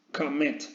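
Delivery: "commit" with the second syllable stressed; stress on last syllable. Shown as a demonstration. Teacher repeating commit for the class, end-stressed. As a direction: land the weight of "commit" on its final syllable. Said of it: "El golpe de voz lo lleva a la última sílaba".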